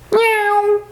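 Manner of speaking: high, long cat meow. Pretend. Teacher imitating a cat for pupils, cat meow. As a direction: mew like a cat.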